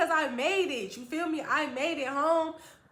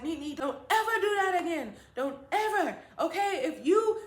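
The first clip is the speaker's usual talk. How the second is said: mildly stern, higher voice; mad but happy mother; 4 semitones higher than usual. Retold or imitated. Mother warning child at reunion, mildly mad. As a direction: imitate a mother's raised-voice warning. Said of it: "she was mad at the same time".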